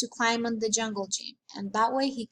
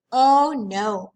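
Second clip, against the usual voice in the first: dismayed cry; 9 dB louder than usual. Acted. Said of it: "my mother cried".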